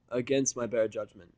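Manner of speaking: ordinary delivery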